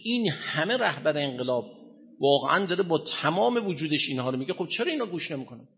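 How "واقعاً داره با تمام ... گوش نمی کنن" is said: usual speaking voice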